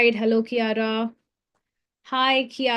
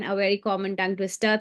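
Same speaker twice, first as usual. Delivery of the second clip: usual speaking voice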